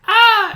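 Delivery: high loud mock shriek of fright; mock scream. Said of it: "Missus Beaver is like".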